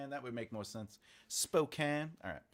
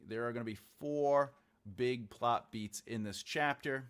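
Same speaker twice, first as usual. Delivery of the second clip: ordinary talk, nothing marked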